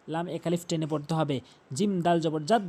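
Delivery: ordinary talk, nothing marked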